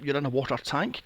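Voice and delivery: ordinary talk, nothing marked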